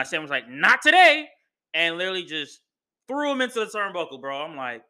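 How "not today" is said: mock defiant retort. Sami's retort acted out.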